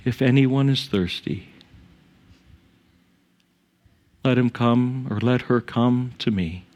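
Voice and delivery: unhurried prayer with long pauses; measured, pause-filled pastoral prayer. Real, not acted. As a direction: pray reverently, leaving silences between phrases. Leads the congregation in prayer.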